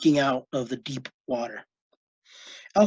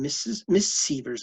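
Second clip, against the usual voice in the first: plain reading voice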